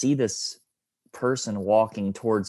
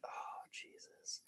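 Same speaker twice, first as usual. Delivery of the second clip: slow, breathy whisper; seeing a stranger approach, whispered; whispered dismay; 27 dB quieter, much slower than usual. Retold or imitated. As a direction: drag it out under your breath. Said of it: "I'm like".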